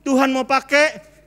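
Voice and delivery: full sermon fervor; forceful preaching. Acted. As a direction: drive the promise home. Preaching climax.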